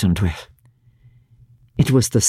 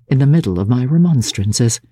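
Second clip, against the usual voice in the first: plain reading voice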